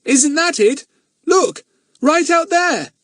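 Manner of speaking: put-on very high, loud boy pointing; boy spotting the lost boat; excited cry. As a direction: shout it out, pointing to sea. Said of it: "Jack gave a cry".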